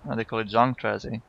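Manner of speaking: ordinary talk, nothing marked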